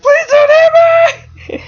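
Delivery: piercingly high, loud comic shriek; panicked scream. Acted. Wolf bracing for her slap, high panicked scream. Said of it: "he's literally just screaming".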